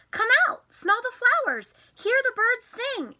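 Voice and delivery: excited call. Acted.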